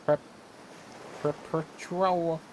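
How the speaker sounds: slow, laboured high attempt at "perpetual"; sounded out slowly. Real, not acted. Working out a hard word.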